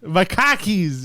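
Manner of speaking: drawn-out Boston "cockies"; Boston accent. Shown as a demonstration. Repeating the word for friends, slow Boston accent. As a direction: stretch "khakis" into Boston "cockies". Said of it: "say words with the accent".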